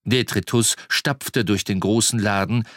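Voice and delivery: ordinary delivery, nothing marked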